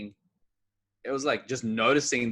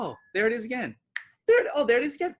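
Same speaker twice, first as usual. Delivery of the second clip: high, louder spotting the feeling returning; amused recognition; 8 semitones higher, 4 dB louder, faster than usual. Retold or imitated. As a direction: catch it sneaking back, playfully.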